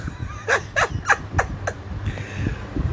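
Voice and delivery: airless high squeal of laughter; breathless laugh. Real, not acted. Vlogger cracking up over the Starbucks story, breathless high squeal. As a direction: laugh so hard no voice comes out.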